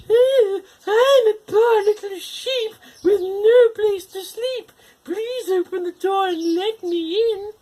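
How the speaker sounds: very high, slow put-on pleading voice; wolf pretending to be a lost sheep; sweet little voice. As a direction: beg pitifully to be let in. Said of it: "in a sweet little voice".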